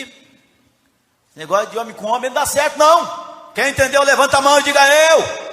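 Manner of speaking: rally the congregation to respond; loud preacher's call and response; full-force preaching. Real, not acted. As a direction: rise into a loud shout.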